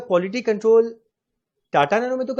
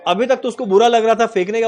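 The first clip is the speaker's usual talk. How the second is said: ordinary delivery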